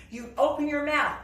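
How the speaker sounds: ordinary delivery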